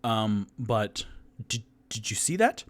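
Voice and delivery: usual speaking voice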